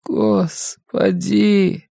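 high, drawn-out frail exclamation; ailing mother dragging the word out with effort; labored speech. A narrator's reading. Mother dismayed at son's hitchhiking, speaking with effort. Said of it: "Каждое слово давалось ей с трудом".

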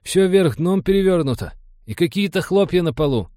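high-voiced, confused crewman; bewildered report. A narrator's reading. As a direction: keep it high, at a loss. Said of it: "растерянно доложил он".

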